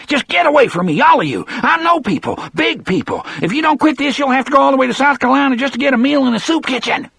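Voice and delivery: high, fast, shrill threats; panicky threats. Acted. Percy threatens the guards in panic.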